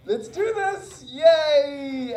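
high, loud, slowed hammed-up cheer; hammed-up enthusiasm for garden work; forced cheer. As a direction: drag out a fake-cheery yay. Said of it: "And I'm like".

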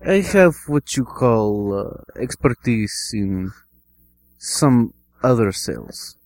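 put-on slow, halting Russian accent; Russian-accented applicant, taking it slow. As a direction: grope slowly for words in a Russian accent. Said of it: "Russian, Russian accent".

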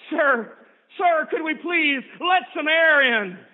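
high, loud, slow begging cry; desperate plea. Acted. Sister crying out to the keeper of hell, slow and loud. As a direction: beg the keeper with everything.

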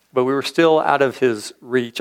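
ordinary talk, nothing marked

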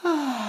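put-on high girlish waking yawn. A narrator's reading. Boucle d'Or waking in the small bed, high yawn. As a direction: yawn high while stretching. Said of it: "s'étira en bâillant".

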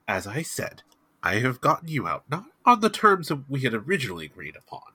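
brisk, formal in-character speech with lifted pitch; formal Abner. Acted. Abner telling the freed prisoner his terms, formal and brisk. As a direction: lay out the terms formally and quickly.

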